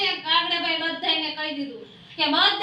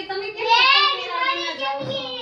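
scolding parrot; 7 semitones higher than usual. Acted. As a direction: tell her off like a fussy parrot.

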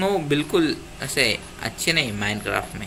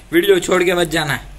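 repeated higher and louder, begging; louder pleading repeat; 4 semitones higher, 5 dB louder than usual. Shown as a demonstration. Gamer begging viewers not to leave, louder higher plea. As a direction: push the plea up, don't leave. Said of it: "तो video छोड़ के मत जाओ please".

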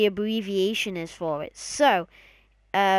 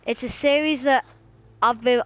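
ordinary delivery

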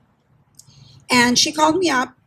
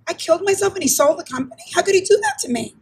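higher, mildly indignant; hurt disbelief; 6 semitones higher, faster than usual. Retold or imitated. Manager thinking over the company being sold, hurt disbelief. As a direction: wonder at the betrayal.

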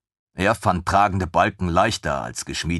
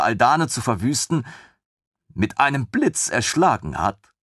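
higher woman's voice, steadying herself; 8 semitones higher than usual. Acted. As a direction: argue it through, forcing calm. Woman argues, composing herself.

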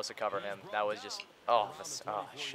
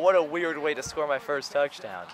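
usual speaking voice

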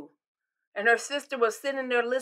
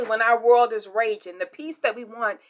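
ordinary delivery

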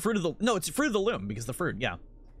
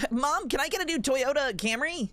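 higher, put-on kid voice; kid asking mom; 7 semitones higher than usual. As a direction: wheedle like a child wanting a treat. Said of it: "Like, somehow kids would".

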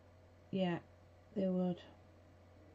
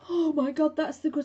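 higher, louder oh my God; excited outburst; 7 semitones higher, 8 dB louder than usual. Real, not acted. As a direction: burst out delighted.

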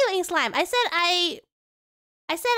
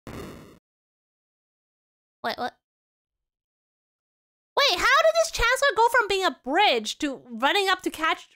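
usual speaking voice

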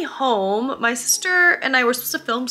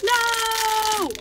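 mock despair; 12 semitones higher than usual. Sold-out sandwiches, retold despair.

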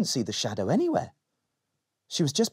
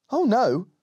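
put-on little girl voice; 9 semitones higher, 4 dB louder than usual. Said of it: "Molly said".

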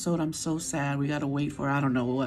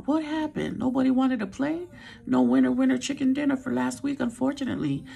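playful outrage; 7 semitones higher than usual. Real, not acted. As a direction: tease them for giving up. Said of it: "I was so upset".

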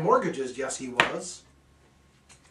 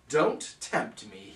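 sly tease; 5 semitones lower, slower than usual. Acted. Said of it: "he laughed".

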